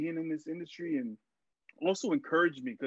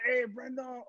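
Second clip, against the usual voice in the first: put-on a clumsy stab at a Mexican accent, pitched much higher; butchered Mexican accent; 10 semitones higher, slower than usual. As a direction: imitate the director's Mexican accent badly, half laughing at yourself. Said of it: "I'm gonna butcher his accent".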